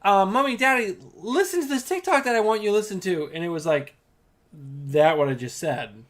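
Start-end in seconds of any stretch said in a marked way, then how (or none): 0.0-3.3 put-on high, louder sweet kid voice; mimicking daughter. Mother mimics daughter sharing a video, high and eager. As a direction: ask like an eager little girl.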